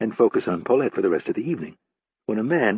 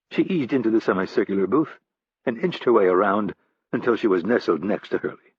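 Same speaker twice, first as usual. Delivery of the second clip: plain reading voice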